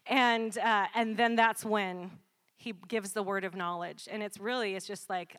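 ordinary talk, nothing marked

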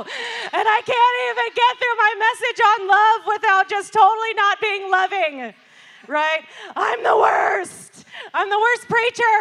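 loud, high wail of self-blame; hammed-up despairing rant, easing off at the end; beating herself up. Preacher praying alone after screaming at kids, loudly beating herself up. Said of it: "now I'm beating myself up".

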